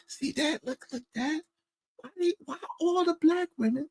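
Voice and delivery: high, soft little voice of his son. Retold or imitated. Son pointing women out to his dad, high hushed imitation. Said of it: "my son, one point out".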